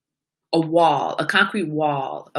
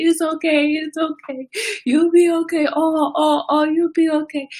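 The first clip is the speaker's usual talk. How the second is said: high, cooing reassurance; baby-soothing; 10 semitones higher, 4 dB louder than usual. Retold or imitated. Owner comforting her hurt puppy like a baby, high cooing reassurance. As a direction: soothe him like a little baby. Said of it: "like he's a little baby".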